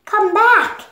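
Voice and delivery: high, loud shout after someone leaving; desperate call to come back. Acted. Joy calls after Sadness.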